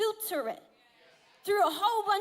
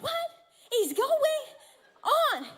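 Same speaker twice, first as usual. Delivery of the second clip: halting, pitched-up bewilderment; baffled disbelief; 7 semitones higher, slower than usual. Retold or imitated. Husband in bed with sobbing wife, acted bafflement. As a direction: break it up word by word, baffled.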